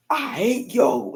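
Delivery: high, very slow spat-out hate; hateful mom. Retold or imitated. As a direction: drag out each word at him with spite. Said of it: "Like that".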